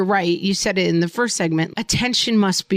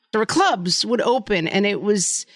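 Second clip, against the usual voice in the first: normal talking voice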